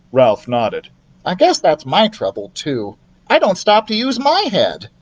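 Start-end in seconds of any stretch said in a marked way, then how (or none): 1.3-4.9 higher rueful mouse; lightly voiced mouse. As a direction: mouse agreeing sheepishly. Ralph voiced.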